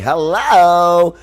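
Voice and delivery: high, loud drawn-out hello; camp producer hello. Retold or imitated. Retelling a Frasier producer's greeting, high camp hello. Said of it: "was like".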